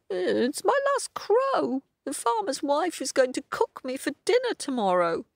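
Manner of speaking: very high bird voice; high-pitched, woeful storybook cockerel; doomed cockerel. Acted. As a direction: squawk out a sorry tale.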